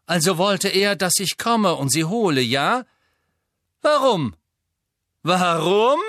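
high, loud, defiant questioning; Harry voice. Acted. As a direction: stall them with pushy questions. Harry stalls the Death Eaters.

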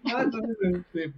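ordinary talk, nothing marked

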